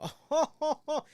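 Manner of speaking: high, loud mock newsman chuckle; fake anchor laugh. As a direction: mimic the anchor's overdone laugh. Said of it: "as soon as we hear Leon".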